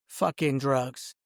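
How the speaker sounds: ordinary delivery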